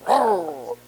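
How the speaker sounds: a playful growl with a rising end; mock growl. Growling from the front row at the teacher, teasing mock growl. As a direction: growl at her like a teasing cartoon lion. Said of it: "She saw me".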